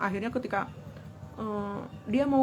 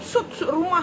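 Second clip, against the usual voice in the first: mock angry order; 8 semitones higher than usual. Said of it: "atau sambil marah-marah".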